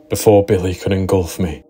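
plain reading voice

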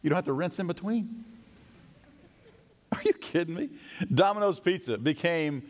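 usual speaking voice

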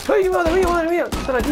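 high, loud startled yelp; startled cry. Real, not acted. Gamer spotting armed enemies, high startled shout. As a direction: jolt up in real alarm on ay.

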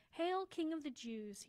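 storybook soldier shouting a mock salute; soldier's yell. A narrator's reading. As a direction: call it out slowly over a crowd. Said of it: "yelled a soldier".

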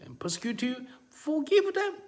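rising to a high, loud cry; building preacher's exhortation. Real, not acted. Preacher urges forgiving those who persecute you, building exhortation. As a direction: build to a shouted command.

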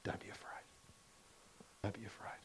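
a hushed, reassuring whisper; soft reassuring whisper. Acted. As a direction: breathe it gently to them. Tender repetition after good news.

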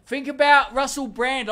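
high, loud Cockney; Cockney accent. Acted. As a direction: push it into a hammed-up Cockney. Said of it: "like the Cockney in there".

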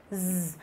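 voiced z, held like a bee's buzz; z as a sustained bee-like hum. Shown as a demonstration. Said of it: "giống như là kiểu cái tiếng con ong".

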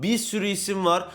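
normal talking voice

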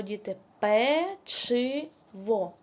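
«печиво» stretched slowly, the «ч» clear; slow, careful pronunciation; drawn-out word. Shown as a demonstration. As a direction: draw out «печиво» for the children. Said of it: "Де ми чуємо звук «ч»".

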